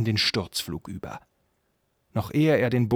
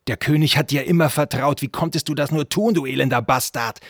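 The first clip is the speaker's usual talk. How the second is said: loud, higher-pitched angry accusation; accusing shout; 5 semitones higher, 6 dB louder than usual. A narrator's reading. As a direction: call the traitor to account loudly. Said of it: "rief Shendur". (laut und anklagend gerufen)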